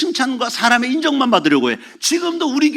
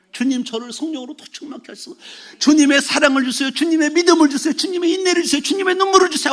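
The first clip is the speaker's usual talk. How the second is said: building higher and higher in pleading; climbing prayer, more urgent each line; rising, earnest petition to the Lord; fervent prayer; 7 semitones higher than usual. Retold or imitated. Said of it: "하고 더 기도하겠지".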